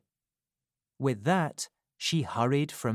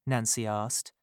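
ordinary delivery, nothing marked

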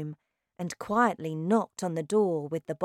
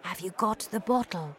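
hushed, breathy whisper; 2 semitones higher, 4 dB quieter than usual. A narrator's reading. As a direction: whisper so nobody hears. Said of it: "My grandmother whispered to me".

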